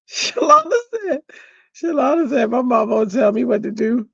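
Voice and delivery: high, giggly quoting; laughing retelling. Retold or imitated. Crafter repeating Shalana's joke about her mom, laughing. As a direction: quote it through high-pitched laughter.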